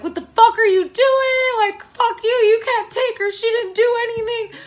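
shrieked high and loud at the cops; a furious, very high drunken yell; retold yell. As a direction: scream it at the officers. Yelling at police arresting girlfriend.

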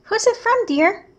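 high sweet wifely voice, pitched well up; postman's wife. Acted. Postman's wife asking her husband who sent the letter, high and sweet. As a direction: ask it lightly, way up high like a curious wife.

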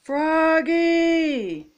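high, long-drawn calling out; coach's yell. A narrator's reading. Coach summons Froggy, yelling. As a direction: call a player from far away. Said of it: "yelled the coach".

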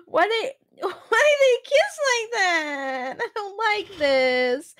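high, drawn-out whiny wail; distressed wail. Real, not acted. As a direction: wail it slowly, half crying, half laughing. Distressed reaction to a disturbing video.

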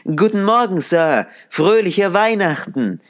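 put-on young fellows calling out merrily; cheerful young lads. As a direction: lads hailing Scrooge on Christmas morning. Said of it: "gut gelaunte Burschen grüßten".

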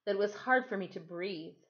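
ordinary delivery

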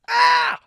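very high, loud scream. Shown as a demonstration. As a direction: let rip a huge high-pitched scream. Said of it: "I'm gonna scream like this".

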